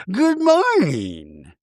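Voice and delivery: high, very slow sing-out hello; mock grand greeting. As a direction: stretch the greeting out theatrically. Voices Meredith calling down.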